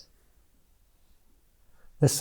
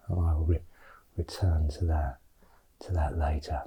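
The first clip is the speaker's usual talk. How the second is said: whispered aside; 8 semitones lower, 12 dB quieter than usual. Real, not acted.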